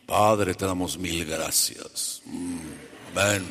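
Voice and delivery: mock the preachy praying voice; slow sanctimonious prayer, then a hushed amen; fake prayer voice. Shown as a demonstration. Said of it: "No cambies la voz cuando estás orando".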